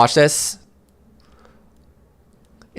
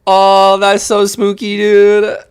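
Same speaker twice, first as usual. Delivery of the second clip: higher and louder, real spooked exclamation; 8 semitones higher, 4 dB louder, slower than usual. Real, not acted. Caster calls the board setup spooky, higher and louder. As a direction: cry out, truly unnerved by the play.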